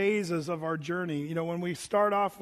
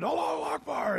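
much higher, called-out Allahu Akbar; crowd echo shout; 9 semitones higher than usual. Retold or imitated. Crowd repeating the cry on the Temple Mount, high shout. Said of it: "everybody yell-, repeated".

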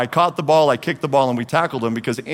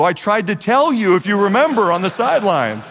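exasperated retort; 9 semitones higher, 4 dB louder than usual. Retold or imitated. Punter retorting to his coach.

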